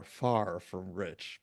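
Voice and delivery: far drawn out, very slowly; slow emphatic. Real, not acted. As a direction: lean on far from rich, slowly. Stressing how poor the family was.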